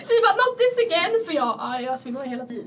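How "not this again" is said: laughing mock groan. Friends groan at another fainting.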